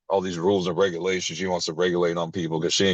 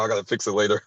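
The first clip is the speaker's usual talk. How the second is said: usual speaking voice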